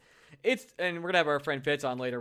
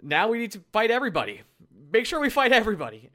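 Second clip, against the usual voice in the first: imitating a fed-up boss, higher and louder; mock a boss's rallying order, pitched up; lightly acted team owner; 7 semitones higher, 5 dB louder than usual. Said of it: "and he was like".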